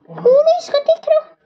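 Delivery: high squeaky little creature asking; tiny toy voice. Pretend. Little toy creature challenging Tikru, high and squeaky.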